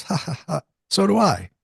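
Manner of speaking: high mock laugh into a breezy reply; imitated laugh. Medium answering his confession that he sees people, mock laughing. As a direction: act out a light laugh, then agree.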